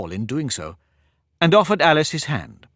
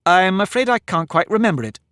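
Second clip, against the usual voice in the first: polite; 7 semitones higher, 4 dB louder than usual. A narrator's reading. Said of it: "Alice said very politely".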